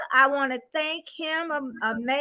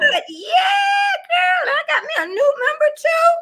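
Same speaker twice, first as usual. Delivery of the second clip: high, loud gleeful announcement; thrilled, pitched way up; excited squeal; 11 semitones higher, 6 dB louder than usual. Real, not acted. Thrilled at a new member.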